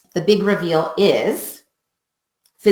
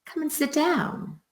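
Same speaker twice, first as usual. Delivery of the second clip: high, soft inviting tone; gentle invite; 8 semitones higher, 8 dB quieter than usual. Shown as a demonstration. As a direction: coax them kindly to sit. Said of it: "same words".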